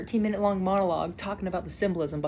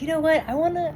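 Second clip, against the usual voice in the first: higher, louder burst of enthusiasm; eager self-quote; 5 semitones higher, 5 dB louder than usual. Retold or imitated. Vlogger retelling their decision to watch the movie, eager. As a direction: quote yourself getting keen, pitched up and loud.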